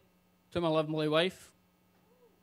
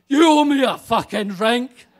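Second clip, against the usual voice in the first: put-on drunken demand; 4 semitones higher, 12 dB louder than usual. Drunk woman demanding again.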